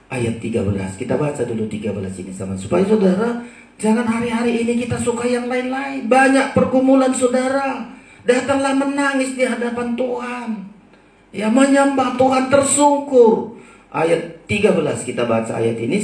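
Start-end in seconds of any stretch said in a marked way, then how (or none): 2.6-13.6 high, forceful preaching; urgent exhortation. Real, not acted. Preacher calling the burdened to worship in tears, urgent exhortation. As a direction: plead with the flock in a raised sermon voice.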